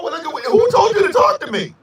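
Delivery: indignant sass. Acted. Indignant mock retort.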